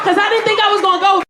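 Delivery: loud, high shouting of thanks to Jesus; jubilant praise. Retold or imitated. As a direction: celebrate like in church, full voice.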